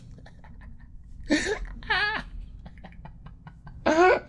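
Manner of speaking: airy high giggle; high wheezing laugh. Real, not acted. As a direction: silent squealing laugh.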